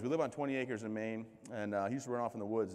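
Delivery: ordinary talk, nothing marked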